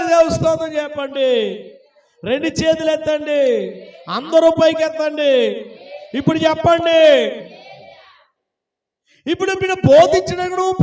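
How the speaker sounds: high-pitched, insistent exhortation; rally the congregation, stretching the phrase endings; forceful preaching. Real, not acted. (ఉత్సాహంగా గట్టిగా ప్రసంగం)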